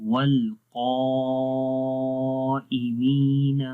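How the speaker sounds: والقائمین chanted very slowly, قا thick and full; slow melodic tajweed of والقائمین; heavy qaf recitation. Shown as a demonstration. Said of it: "قاف کی وجہ سے الف کیا ہو رہا ہے؟".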